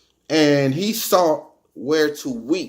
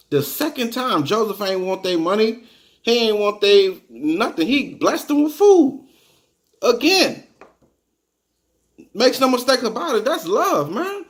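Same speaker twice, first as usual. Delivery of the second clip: high, fired-up preaching voice; rising preaching; 7 semitones higher than usual. Real, not acted. Preacher marveling at Joseph's love for his brothers, fired up. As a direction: lift up and testify about it.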